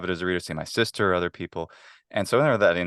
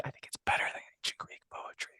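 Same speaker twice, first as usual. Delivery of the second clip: conspiratorial whisper; 11 dB quieter than usual. Real, not acted. Whispering a controversial opinion to the interviewer. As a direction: whisper it like a guilty secret.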